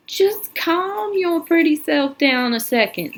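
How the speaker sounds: slow, high condescending sheriff drawl. Acted. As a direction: drawl it out to calm her. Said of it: "in a slow drawl".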